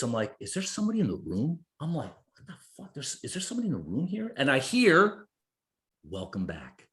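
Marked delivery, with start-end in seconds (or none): 0.4-4.3 hushed, hurried nervous questioning. Retold or imitated. Man waking on the floor checks for someone, nervous. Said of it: "I got a little nervous". 6.0-6.9 low, very soft voice from nowhere; hushed otherworldly greeting. Retold or imitated. Unseen voice greets man coming to, low and hushed. Mysterious voice greets him on waking.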